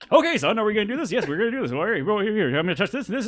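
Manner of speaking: pepped way up, high rapid patter; hyped-up and pitched high, rattling it off; wildly over-caffeinated character voice; Vernon. Acted. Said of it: "he peps way the fuck up".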